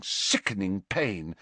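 usual speaking voice

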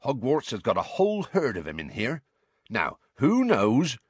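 rustic gamekeeper addressing his class; hearty, informal teacher with a country lilt; lightly voiced Hagrid, genial gamekeeper.

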